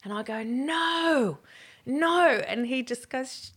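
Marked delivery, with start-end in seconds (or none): 0.4-2.3 higher, louder drawn-out no; alarmed protest. Retold or imitated. Begging husband not to pick up the hitchhikers, loud and high.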